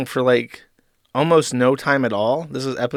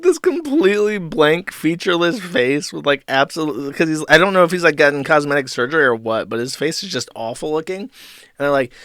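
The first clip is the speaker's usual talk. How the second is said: ordinary talk, nothing marked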